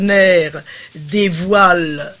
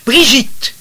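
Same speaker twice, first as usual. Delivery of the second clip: a higher, louder call to silence her; sharp cry of a name; 5 semitones higher, 5 dB louder than usual. A narrator's reading. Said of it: "cria madame de D".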